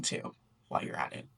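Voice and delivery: whisper. Acted. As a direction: toss it off in a quick whisper. Whispered aside.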